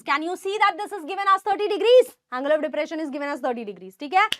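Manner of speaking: normal talking voice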